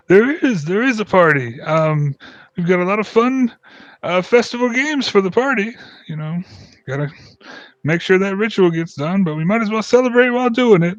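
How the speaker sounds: high, hearty festival host; jolly dwarf. Acted. Dwarf inviting the group to festival games, high and jolly. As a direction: sell the party with a big grin.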